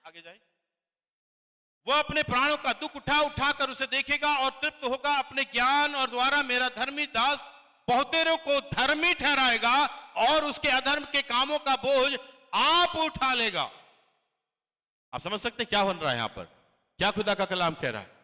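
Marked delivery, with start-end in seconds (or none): 1.9-13.7 high, loud, proclaiming reading; loud scripture reading. Acted. Pastor reads Isaiah aloud to congregation, loud and proclaiming. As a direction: declaim the verse to the hall.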